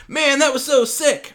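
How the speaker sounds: high, loud, hyped-up praise; impressed slang. Acted. Example of sick as slang, excitedly impressed. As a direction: rave like you just saw a great trick.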